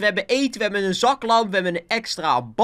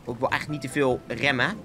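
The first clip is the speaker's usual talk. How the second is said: usual speaking voice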